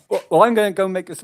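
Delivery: normal talking voice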